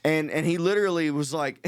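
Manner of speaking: usual speaking voice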